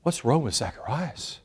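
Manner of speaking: hushed, puzzled questioning; puzzled onlookers. Retold or imitated. People wondering about Zacharias, hushed puzzlement. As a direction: drop very soft, as if murmuring in a crowd. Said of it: "people are like".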